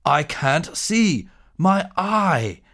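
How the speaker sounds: slow, pained giant's roar rising high. Acted. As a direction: bellow in pain like a wounded giant. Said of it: "Gawk roared".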